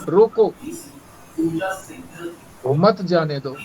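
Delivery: "रोको," stressed and rising, then a pause before "मत जाने दो"; pause after roko. Shown as a demonstration. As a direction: break right after "रोको". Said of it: "एक अर्थ क्या?". ("रोको" पर ज़ोर, फिर रुककर "मत जाने दो")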